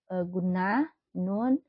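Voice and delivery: usual speaking voice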